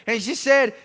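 ordinary delivery

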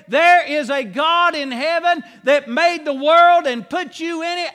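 fervent rising preacher's call; preaching at full force. Acted. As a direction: lift the congregation up with it. Preacher rises in intensity.

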